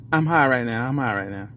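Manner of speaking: stoner. Retold or imitated. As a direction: drop way down and sound stoned. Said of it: "my best thugger thugger".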